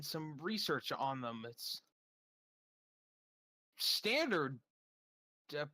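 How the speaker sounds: halting, evasive answer with long pauses; reluctant. Acted. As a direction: stall between words, holding the information back. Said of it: "she seems, uh, reluctant to tell you".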